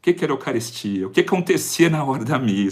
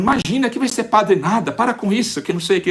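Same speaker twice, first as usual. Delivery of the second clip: higher, lightly voiced scolding mother; imitating his mother; 8 semitones higher than usual. Mother brushing off her son's wish, imitated higher.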